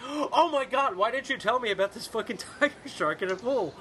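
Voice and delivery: mock horror. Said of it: "they're like, gasps".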